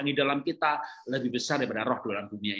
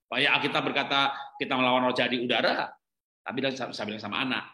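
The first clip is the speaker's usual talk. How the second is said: usual speaking voice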